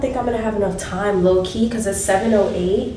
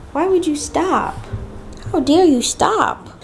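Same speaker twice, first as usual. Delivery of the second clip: higher, mock-indignant baby voice; mock baby outrage; 7 semitones higher than usual. Mom voicing her baby's mock outrage, higher. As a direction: play the offended baby, hammed up.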